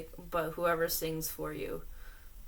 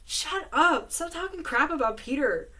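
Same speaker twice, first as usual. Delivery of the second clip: mock outrage; 6 semitones higher, 5 dB louder than usual. Real, not acted. Defending Peter from fan letter.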